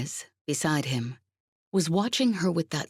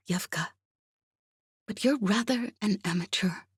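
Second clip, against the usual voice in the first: a lightly voiced cool spy, higher and hushed; Masha, calm and superior; 5 semitones higher than usual. A narrator's reading.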